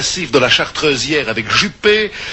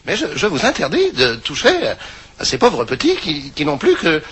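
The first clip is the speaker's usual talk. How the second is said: put-on old grandma voice. Grandma scolds the men.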